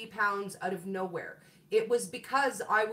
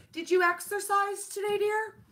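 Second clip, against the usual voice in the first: lightly voiced lady, pitched higher; higher, mild imitation of a lady in town checking up; sweet older lady; 9 semitones higher than usual. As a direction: sweetly nudge her, like a neighbour. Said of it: "she's like".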